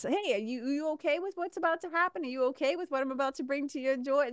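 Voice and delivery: high, fast mock-polite question; voicing life. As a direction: ask like life checking in, quickly. Life voiced as a person.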